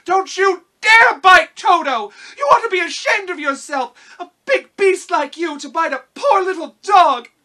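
put-on furious high girl's shout; indignant cry. A narrator's reading. Dorothy scolds the lion to save her dog, indignant high shouting. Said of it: "while she cried out".

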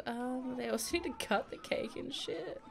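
ordinary talk

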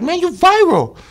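way up high, teasing; high mock detective voice. Storyteller mimicking the detective's teasing greeting, high and mocking. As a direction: pitch it high like a mocking cop.

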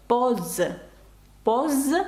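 "Поз" ending in a voiced Z; "Поз" with a buzzing Z ending; S read as Z. Shown as a demonstration. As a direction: say French "Поз" with a clear final Z. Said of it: "Тому це звук З".

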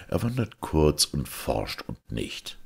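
ordinary delivery

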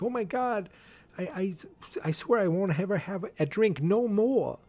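high-pitched, stammering mock panic; panicked drunk. Scared driver swearing off drink, panicked.

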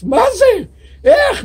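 high, loud cry of disbelief; shocked outburst. Acted. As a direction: blurt it out, stunned and furious. The rich man explodes in surprise. (צעקת תדהמה גבוהה)

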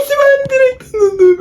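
very high, squealing game yell; excited shriek. Real, not acted. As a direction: shriek as the chance slips away. Kaleci gelirken panikle bağırıyor. (tiz, heyecanlı çığlık)

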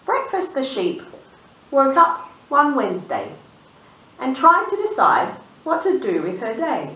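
lively storybook reading; reading to children. A narrator's reading. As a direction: read it like a bedtime picture book. Reads picture book aloud.

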